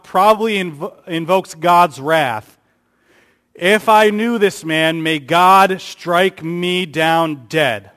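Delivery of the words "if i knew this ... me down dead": solemn curse. Acted. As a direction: call down the curse on yourself. Peter's curse voiced slowly.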